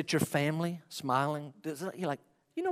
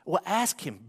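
normal talking voice

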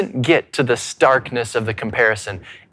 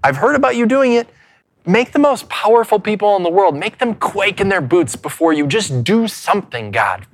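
raised, urgent prayer to God; pleading prayer; 4 semitones higher than usual. Acted. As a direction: plead with God to act now. Pastor voices a pleading prayer.

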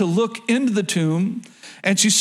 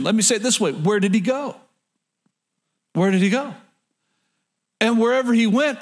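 ordinary talk, nothing marked

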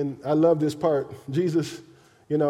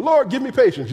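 mock prayer; 7 semitones higher, 4 dB louder than usual. Mock prayer quoted in sermon.

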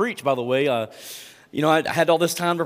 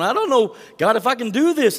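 high, loud mock panic; worked-up, higher-pitched fretting; panicked; 8 semitones higher, 5 dB louder than usual. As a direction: retell a panicked protest to God. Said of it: "I'm all panicked going".

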